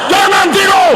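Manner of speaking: high, booming order; loud shout. Shown as a demonstration. Preacher ordering sleepy audience to stay awake, loud shout. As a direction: yell it to jolt sleepers awake. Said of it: "suara saya kuat".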